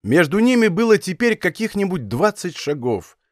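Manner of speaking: ordinary delivery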